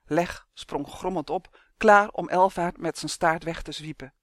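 ordinary delivery

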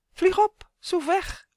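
very high, excited urging. A narrator's reading. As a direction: egg him on to take off. Voice in head urges flying. (hoog, opgewonden aansporend)

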